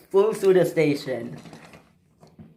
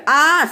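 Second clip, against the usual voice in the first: short high, loud "ah" scream; 11 semitones higher, 6 dB louder than usual. Acted. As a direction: cry out in alarm, high and loud. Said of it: "screamed Hugo".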